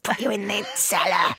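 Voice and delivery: sweet doll voice turning sinister; cheery doll with a creepy edge; evil talking doll. Acted. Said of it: "it's like an evil".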